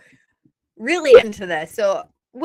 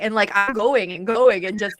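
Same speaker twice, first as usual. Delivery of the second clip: ordinary delivery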